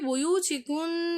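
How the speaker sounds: "புயூச்சிக்குன்" chanted slowly with the final n held; clear ghunna demo. Shown as a demonstration. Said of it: "என்று மிக தெளிவாக". ("புயூச்சிக்குன்" இறுதி ன் நீட்டி தெளிவாக ஓதுதல்)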